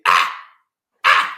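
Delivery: two short, loud mock boxer grunts; imitated punch grunts. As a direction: mimic a boxer's sharp "ah" on each punch. Imitating boxer's noise when punching.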